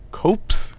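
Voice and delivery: "copes" with a long o, rhyming with hopes; tentative "copes", o as in boat; long-o pronunciation. Shown as a demonstration. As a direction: say "copes" high and questioning, long o. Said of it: "I guess I always assume".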